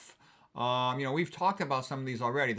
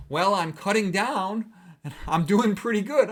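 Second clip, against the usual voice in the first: mock patient; 5 semitones higher, 6 dB louder than usual. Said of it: "And she's like".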